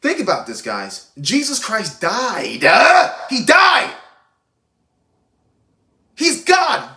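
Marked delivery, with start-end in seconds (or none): 1.2-7.0 booming sermon outburst; preaching shout. Real, not acted. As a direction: shout it out slow like a fired-up preacher. Preaching at full force about God.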